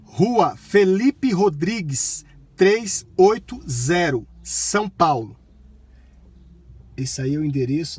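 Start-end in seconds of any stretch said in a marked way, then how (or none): 0.0-5.3 loud, slow, word by word; talking to voice assistant. Real, not acted. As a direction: dictate it clearly to the car.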